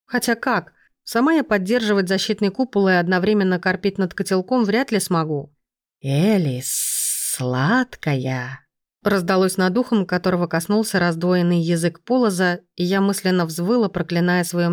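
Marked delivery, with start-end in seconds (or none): 6.0-8.7 low, wheedling snake voice; cooing serpent. A narrator's reading. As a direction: purr her name like a snake. Serpent coos in her ear.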